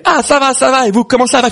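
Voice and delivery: very high, chirpy comic reply; exaggerated greeting back. The rabbi answers the two fathers in a joke, exaggerated greeting. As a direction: toss the pleasantries back high up.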